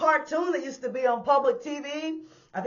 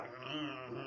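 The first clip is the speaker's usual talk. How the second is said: lower, softer attempt at the groan; half-hearted moan; 10 semitones lower, 18 dB quieter than usual. Retelling the man's guttural sounds. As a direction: try the moan again and give up. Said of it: "I wish I could do it".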